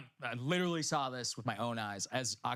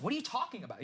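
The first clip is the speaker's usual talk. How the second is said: ordinary talk, nothing marked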